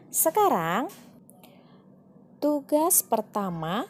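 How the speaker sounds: ordinary delivery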